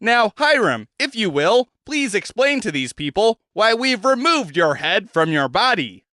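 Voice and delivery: a hint of a cheerful showman; Zed's voice. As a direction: coax the prisoner along brightly. Zed addresses the head.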